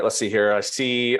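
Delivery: ordinary talk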